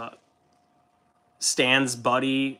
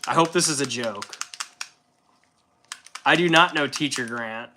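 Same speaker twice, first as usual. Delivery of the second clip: usual speaking voice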